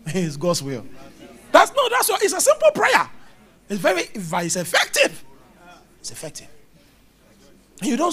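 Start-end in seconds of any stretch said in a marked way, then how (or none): 1.5-3.1 very high, loud insistence; emphatic protest. Real, not acted. Preacher insists over congregation's reaction. 3.7-5.2 EFFECTIVE punched out, rising; forceful, rising insistence; emphatic assertion. Real, not acted. Punches the point, then repeats softly. 6.0-6.5 much softer, lower aside; quiet, low afterthought; hushed repeat. Real, not acted. Soft repeat of the point.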